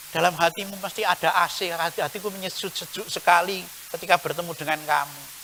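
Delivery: usual speaking voice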